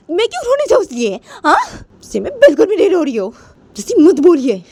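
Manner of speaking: acted hurt quarrelling; upset protest. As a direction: protest indignantly, pitch climbing high. Poonam's tearful accusation and denial.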